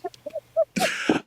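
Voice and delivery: high rising cry-laugh; squealing laugh. Real, not acted. Ending the theater story, high squealing laughter.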